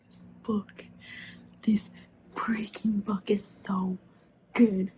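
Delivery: hushed awe; awed whisper. Real, not acted. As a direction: breathe it out, overwhelmed. Whispered awe after sigh.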